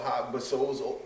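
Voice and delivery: ordinary talk, nothing marked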